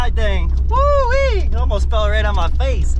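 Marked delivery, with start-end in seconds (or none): 0.7-1.4 excited whoop. Real, not acted. Riding the jeep, cheering.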